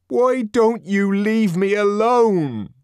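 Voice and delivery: high, loud, drawn-out anguished cry; plaintive anger. A narrator's reading. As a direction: shout angrily, pleading to be left alone. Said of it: "almost plaintive note in his anger".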